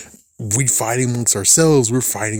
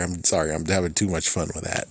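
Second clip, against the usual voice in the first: normal talking voice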